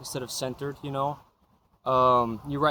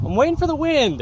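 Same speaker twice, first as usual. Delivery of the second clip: much higher, loud call over the gusts; shouting over wind; 14 semitones higher, 7 dB louder than usual. Real, not acted. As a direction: yell it above the wind.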